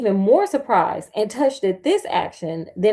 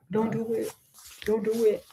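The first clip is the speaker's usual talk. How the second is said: softer mock pleading; begging cow; 5 dB quieter than usual. Cow begging not to be killed, softer mock pleading. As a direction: plead lightly as a doomed cow. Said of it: "a whole cow over here begging".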